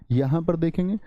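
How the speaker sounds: normal talking voice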